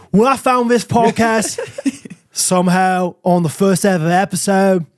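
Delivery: rough attempt at a London accent; gritty London accent. Podcaster reading a fan's heartfelt review in shaky London accent. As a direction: put on London, drifting toward Australian. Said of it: "gritty London people, like, British people".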